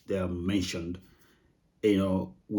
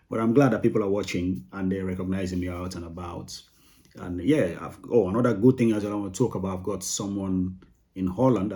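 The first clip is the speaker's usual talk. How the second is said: ordinary talk, nothing marked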